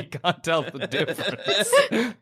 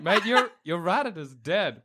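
normal talking voice

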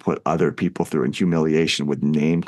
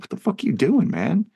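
mock bystander; 14 semitones higher than usual.

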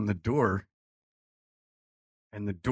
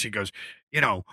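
normal talking voice

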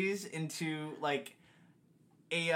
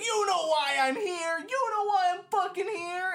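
menacing mock threat; 3 dB louder than usual. Customer at the drive-through demanding the meme order, hammed-up menace. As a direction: spit it out like a hammed-up intimidating customer. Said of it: "It's like".

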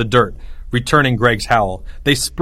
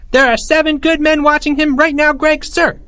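high, squeaky little subordinate; small voice; 5 dB louder than usual. A narrator's reading. Third-grade leader answering his general, small voice. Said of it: "said a small voice".

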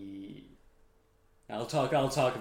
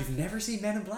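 surprised laugh; 10 semitones higher, 4 dB louder, faster than usual. Real, not acted. Co-host hasn't seen the film.

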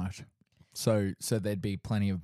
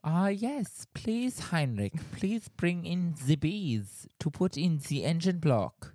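high, put-on German accent; mock Germanic boss giving orders; 8 semitones higher than usual. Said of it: "I've been working on my German accent".

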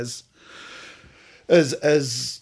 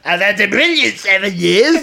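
hammed-up Scottish-ish accent, higher and louder; giggle-tinged mock rock-star voice; 10 semitones higher, 10 dB louder than usual. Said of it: "you can't do that accent".

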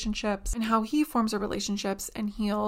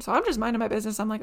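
usual speaking voice

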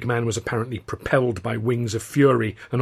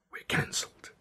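hushed, voiceless whisper; soft breath with no voice; 10 dB quieter than usual. A narrator's reading. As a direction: whisper terrible news, stunned. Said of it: "he whispered".

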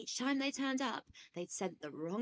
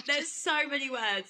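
laughing; 5 semitones higher, 9 dB louder than usual. Real, not acted. Laughing reply.

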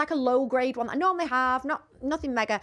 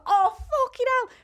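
shocked exclamation; 4 dB louder, slower than usual. Retold or imitated. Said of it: "I was like".

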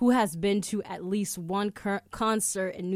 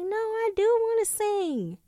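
much higher, sweet mock protest of [name]; 14 semitones higher, 4 dB louder, slower than usual. [name] insisting to [name] she wants to sing, high mock voice. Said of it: "And she was like".

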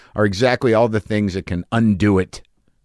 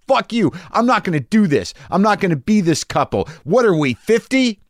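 much higher, hammed-up furious retort; mock angry yell; 11 semitones higher than usual. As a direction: throw the insult at a shriek. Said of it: "And I said".

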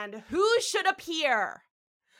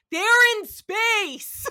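high, loud, drawn-out outburst; mock-outraged shout; exasperated exclamation; 10 semitones higher, 10 dB louder, much slower than usual. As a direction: exclaim it big and slow in disbelief. Mock exclaiming at useless hat.

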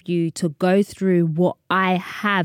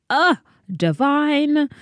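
savouring delight; 8 semitones higher, much slower than usual. Real, not acted. Savouring her favourite food.

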